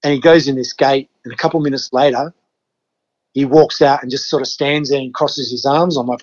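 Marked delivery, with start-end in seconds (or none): none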